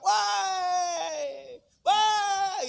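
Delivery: high, loud mock yell; yelling at child. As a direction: bellow at the kid across the house. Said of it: "Woi, anak saya". (teriakan pura-pura memanggil anak)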